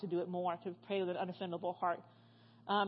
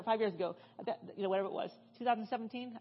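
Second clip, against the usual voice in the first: ordinary talk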